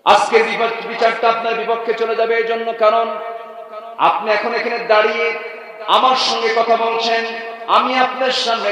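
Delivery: high, loud declamatory sermon chant; ringing preacher's delivery; full-force preaching. Acted. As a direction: proclaim it to the whole gathering on a high held pitch.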